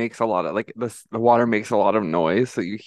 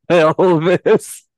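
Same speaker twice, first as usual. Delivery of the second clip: laughing; 5 semitones higher, 8 dB louder, much slower than usual. Real, not acted. Laughs while excusing herself.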